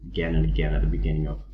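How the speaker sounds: ordinary delivery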